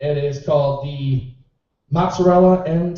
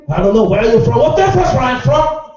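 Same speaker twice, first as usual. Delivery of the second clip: mock indignant shout; 7 semitones higher, 7 dB louder than usual. Comic quotes a heckled exchange.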